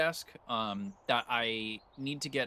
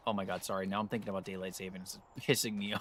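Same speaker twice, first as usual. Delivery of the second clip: ordinary delivery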